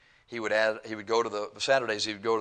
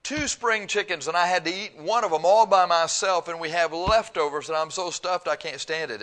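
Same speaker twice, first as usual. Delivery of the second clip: put-on higher, louder little girl bragging; child's boast; 7 semitones higher, 6 dB louder than usual. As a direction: play a stuffed little girl showing off. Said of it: "she said".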